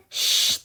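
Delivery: unvoiced sharp shush; hushing shh. Sebastian hushing Ariel, a sharp unvoiced shh. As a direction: hush her to be quiet.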